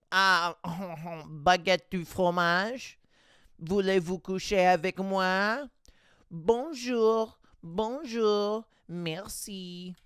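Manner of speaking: hammed-up fake French accent; mock French accent. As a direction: parody a tourist's French clichés. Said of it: "It sounds like a fake accent".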